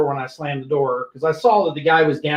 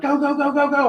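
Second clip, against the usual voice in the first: urgent; 8 semitones higher than usual. Retold or imitated. Urging rookie driver in pursuit.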